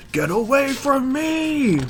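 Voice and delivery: high, slow, drawn-out Get away from me; slow high shriek. Acted. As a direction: stretch it out in a high panicked cry. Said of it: "the big panel that says".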